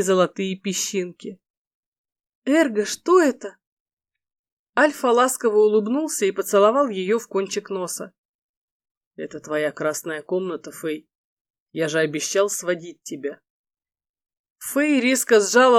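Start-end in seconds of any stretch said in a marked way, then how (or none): 2.4-3.6 put-on light higher girl voice; female character voice. Фэй, женский голос героини. 9.2-13.4 lower, softer male lead; soft low voice of the husband. Acted. Эрго, мужской голос.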